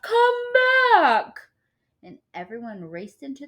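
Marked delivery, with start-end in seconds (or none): 0.0-1.4 much higher, loud call. A narrator's reading. Mister Sims calling his cat back, loud shout. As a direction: yell after the cat. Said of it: "Mister Sims called out".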